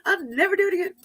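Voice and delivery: put-on high, loud, frantic child's begging; a hammed-up terrified child pleading; panicked pleading. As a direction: beg in a panic like a scared little girl. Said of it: "she was, like, flipping out".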